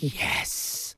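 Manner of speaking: hushed, voiceless mock cheer; secret whispered cheer. Listener imagines the storyteller's secret reaction, whispered cheer. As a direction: whisper it like a private victory. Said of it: "secretly, were you like".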